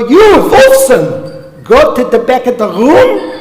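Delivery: high, loud mock-furious teacher yell; hammed-up scolding, slow and loud at the start; yelled curse imitation. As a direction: yell it like an exasperated Hebrew teacher, drawing out the name. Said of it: "he'd yell this at me".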